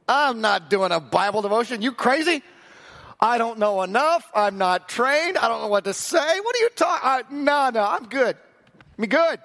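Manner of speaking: high, incredulous mock protest; panicked refusal. New volunteer refusing to lead the Bible devotion, hammed-up panic. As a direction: rattle off excuses in rising alarm.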